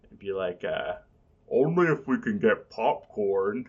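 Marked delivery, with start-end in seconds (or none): 1.5-3.7 Hungry Man voice. Acted. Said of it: "what the Hungry Man would say".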